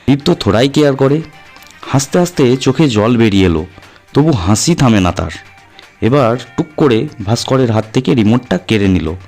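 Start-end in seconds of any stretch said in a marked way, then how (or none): none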